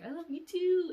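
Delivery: sweet high reply. Retold or imitated. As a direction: chirp it back sweetly. Replying to boyfriend's confession.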